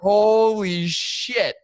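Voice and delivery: very slow, drawn-out, loud and high holy shit; stunned exclamation. Retold or imitated. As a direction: stretch it out in disbelief. Stunned as the bird takes flight.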